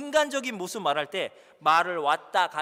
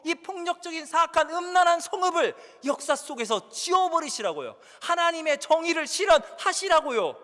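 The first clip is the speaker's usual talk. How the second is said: angry protest; 3 semitones higher than usual. Acted. Preacher voicing Jonah, railing indignantly at God. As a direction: rail at God as a furious prophet.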